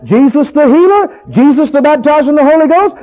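preacher's climbing praise cry; rising praise. Real, not acted. Preacher builds praise chant.

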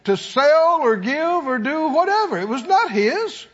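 high-pitched, driving sermon delivery; full-force preaching. Real, not acted. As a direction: preach it hard, phrase by punched phrase.

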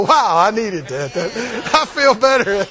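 mock relieved sigh of a churchgoer; relieved listener. Preacher voicing a chastened believer, mock relief. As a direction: act out the grateful hearer, lightly. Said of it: "you go".

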